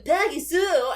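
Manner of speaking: high, loud goofy nerd whine; nerd voice impression. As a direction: call her name like a dorky teen nerd. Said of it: "and he'd be like".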